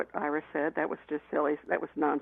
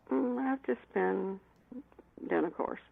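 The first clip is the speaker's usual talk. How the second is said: slow, sheepish mumble under the breath; mumbling quietly; 2 semitones higher, 6 dB quieter than usual. Retold or imitated. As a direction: mumble it so she can't hear. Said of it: "Speak up, speak up".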